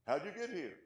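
acted incredulous questioning; puzzled demand. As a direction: play a baffled angel questioning an arrival. Acted angel questioning the thief.

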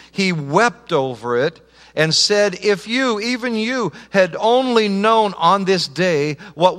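impassioned pulpit preaching, high and loud; a pastor pleading with his Palm Sunday congregation, fervent full-voiced preaching; full-force preaching. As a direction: drive it home like a revival preacher.

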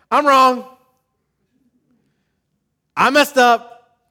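high, loud and slowed confession; mock loud confession. Pastor voicing churchgoers admitting they're wrong, loudly. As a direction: belt out the admission of guilt. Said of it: "you guys like to say".

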